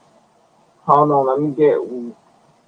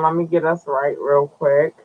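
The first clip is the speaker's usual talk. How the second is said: ordinary talk, nothing marked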